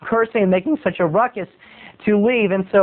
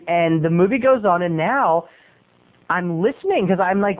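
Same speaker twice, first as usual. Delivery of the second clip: usual speaking voice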